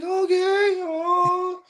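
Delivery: put-on very high, loud whimpering child voice; high mock whimper; imitated frightened daughter. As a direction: mimic a panicked kid trying to cope. Imitates frightened daughter answering dad.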